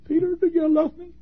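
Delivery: God pleading with Peter, reaching out in longing; yearning plea. Acted. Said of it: "reaching his hands out saying".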